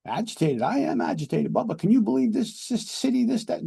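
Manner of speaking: high-pitched, worked-up rant; acted agitation. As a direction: play an indignant passenger going off. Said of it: "you seem really agitated".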